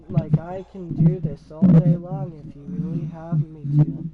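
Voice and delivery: low robot monotone; robot impression. Shown as a demonstration. Showing viewers the act can go all day, robot impression. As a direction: talk like a robot, deep and even. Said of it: "exact impression of a robot".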